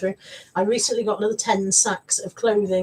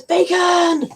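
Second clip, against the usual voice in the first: reading out a bacon cry; 8 semitones higher, 5 dB louder than usual. Retold or imitated. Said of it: "Dave, who says".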